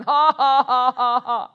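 loud, forced "ha ha" laugh; put-on laugh. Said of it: "I put that laugh on".

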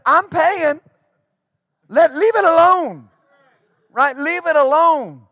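stern shouted leave it alone; firm loud command. Retold or imitated. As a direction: break up the argument with force. Intervening in a fight.

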